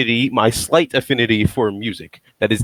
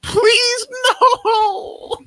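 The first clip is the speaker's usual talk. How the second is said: very high laughing no; laughing plea. Real, not acted. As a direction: beg through laughter.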